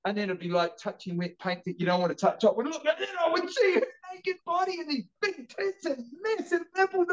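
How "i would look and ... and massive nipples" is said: very high, wailing retelling; hammed-up childish distress, pitched way up; mock horror. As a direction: wail like a scandalised little kid. Acting out horrified childhood peek.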